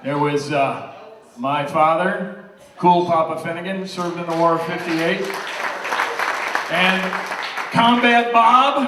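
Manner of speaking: loud, proud toast to the room; rally the room with pride; father of the bride speech. Real, not acted. Toasting family members at the wedding.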